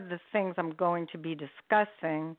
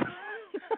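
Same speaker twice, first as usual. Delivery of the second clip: a high, soft laugh; shy laugh; 12 semitones higher, 11 dB quieter than usual. Real, not acted. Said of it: "I know I have a scratchy voice".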